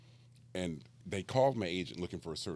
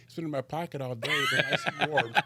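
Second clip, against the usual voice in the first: high voice breaking into laughter; laughing girl voice; 8 semitones higher, 5 dB louder than usual. Retold or imitated. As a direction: squeak it out through giggles. Girl offers warm gummy bear.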